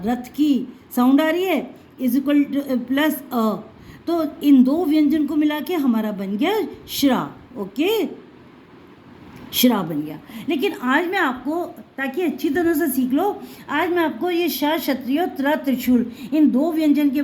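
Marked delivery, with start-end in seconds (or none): none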